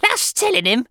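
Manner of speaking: high, loud screech of approval; excited harpy's shriek. A narrator's reading. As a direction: cheer on the punishment shrilly. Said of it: "shrieked Missus Pratchett".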